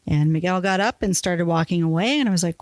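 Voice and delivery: usual speaking voice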